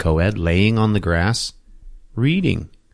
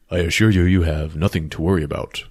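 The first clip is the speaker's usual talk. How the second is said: lower, lightly voiced serious man; stoic professor; 5 semitones lower than usual. Professor reassures his student, lightly mock-stoic. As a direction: imitate a stoic teacher, low and restrained. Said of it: "He's a very stoic, uh, serious man".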